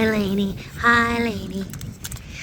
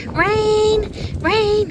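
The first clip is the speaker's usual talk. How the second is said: higher, louder call across the yard; 7 semitones higher, 4 dB louder than usual. Real, not acted. Owner calls Rain over to say goodbye, loud call. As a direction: call the dog's name. Calling the dog.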